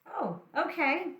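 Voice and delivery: ordinary delivery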